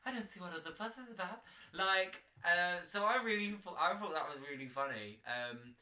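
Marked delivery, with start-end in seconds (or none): 0.0-1.4 fast, higher, playful quote; quoting Rose from Titanic. Retold or imitated. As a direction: rattle off the famous line. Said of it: "I've been like".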